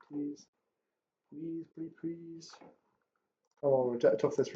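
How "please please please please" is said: higher and much softer, begging; quiet pleading. Real, not acted. Trader begs the chart to move, higher and soft. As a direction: plead under your breath, pitch up.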